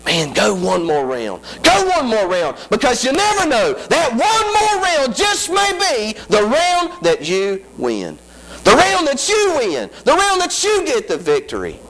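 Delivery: high, rising preacher's whoop; preaching at full force. Acted. Preacher whooping to the congregation about her struggle. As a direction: lift it into a sung sermon cry.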